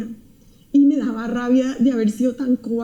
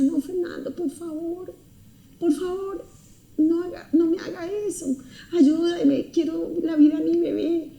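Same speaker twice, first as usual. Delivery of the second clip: tearful pleading; 6 semitones higher than usual. Retold or imitated. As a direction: plead with the boyfriend, crying. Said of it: "yo me puse a llorar y le decía". (súplica llorosa, aguda y suave)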